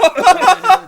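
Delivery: high loud cackle; loud laugh burst. Real, not acted. Friends watching the reading, a loud cackling laugh.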